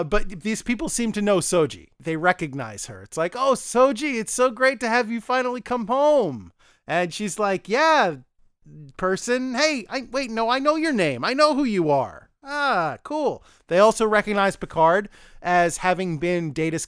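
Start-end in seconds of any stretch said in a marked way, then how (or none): 3.4-6.5 higher-pitched mock greeting; acted delighted homecoming voice; gushing welcome. Voicing townspeople greeting Soji. 7.7-13.4 higher, awkward bluffing voice; acted awkward friendliness; faked recognition. Voicing Soji faking recognition of people.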